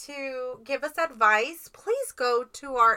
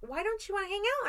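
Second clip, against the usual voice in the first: quoting friend; 9 semitones higher, 6 dB quieter than usual. Retold or imitated. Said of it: "And so she was like".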